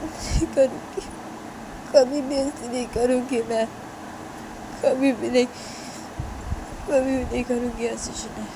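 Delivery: halting plea that cracks into whispers; tearful pleading. Acted. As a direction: beg her forgiveness through tears. Tisha begs forgiveness after falling.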